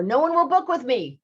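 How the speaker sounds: high, loud call. Retold or imitated. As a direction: shout it into the canyon.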